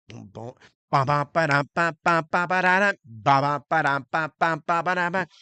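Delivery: vocalized rap backing riff; imitated beat. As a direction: sing out the beat in ba-ba syllables. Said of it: "he goes".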